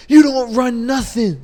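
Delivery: loud, high-pitched mock yell; imitated screaming. Veteran putting down the new helper, imitated scream. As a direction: imitate a boss tearing into a newcomer. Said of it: "He just starts screaming".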